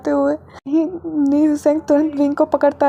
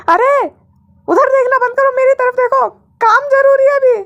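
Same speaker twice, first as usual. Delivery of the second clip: much higher, louder scolding boss; sharp scolding; 10 semitones higher, 5 dB louder than usual. A narrator's reading. Boss scolding starstruck assistant, high sharp. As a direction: snap him back to work.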